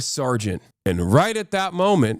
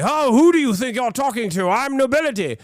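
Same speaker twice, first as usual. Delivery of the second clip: much higher, snooty noble voice; higher pompous nobleman; haughty noble; 10 semitones higher than usual. Retold or imitated. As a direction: look down your nose at the guard. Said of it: "if you say".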